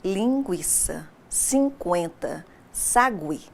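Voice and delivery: each word said slowly, the ü in linguiça, cinquenta and sagui clearly sounded; slow demo pronunciation. Shown as a demonstration. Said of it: "Continuamos a falar linguiça". (pronúncia lenta e clara, soando o u)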